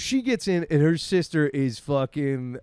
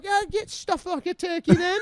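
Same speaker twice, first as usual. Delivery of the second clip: high, cheerful mum voice; mom's voice; 13 semitones higher than usual. Retold or imitated. Mum cheering on the hookup, high and cheery. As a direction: say it like a shameless mum. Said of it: "a mom be like".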